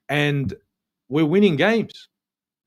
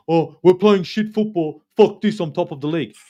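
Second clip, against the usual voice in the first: mocking moaning fan; 3 semitones higher, 6 dB louder than usual. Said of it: "I'm not gonna come down here and moan".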